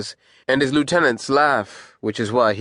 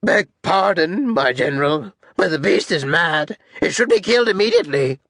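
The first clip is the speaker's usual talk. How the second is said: higher, anxious subordinate voice; pleading captain; 8 semitones higher than usual. Acted. Captain excuses himself to his general, pleading. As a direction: plead upward to a superior, urgent by the end.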